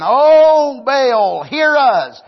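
loud, high calling out; prophets of Baal crying out. Acted. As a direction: call out to Baal again, pleading.

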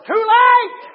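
sudden loud, high cry; full-force pulpit shout; shouted warning. Real, not acted. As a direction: hurl the refrain at the congregation. Preacher shouts the refrain.

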